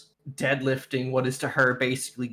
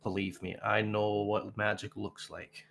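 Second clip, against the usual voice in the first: somewhat quiet, in character; 2 semitones lower, 6 dB quieter than usual. Acted. Leaning in to confide in Valen, somewhat quietly. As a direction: lean in and say it softly, unhurried. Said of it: "somewhat quietly says".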